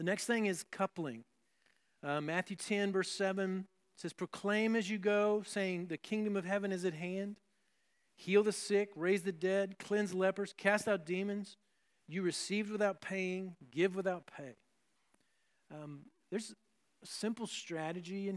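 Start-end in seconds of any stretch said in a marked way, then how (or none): 4.1-14.6 scripture reading. Acted. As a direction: proclaim the verses like a preacher reading. Reads Matthew ten aloud.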